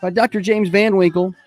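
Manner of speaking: upbeat morning radio host read; radio announcer. Acted. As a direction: sell it like a live radio spot. Radio host opening and ad reads.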